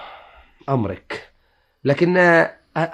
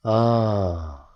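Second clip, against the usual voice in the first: long resigned sigh; 8 semitones lower, 7 dB quieter, much slower than usual. Acted.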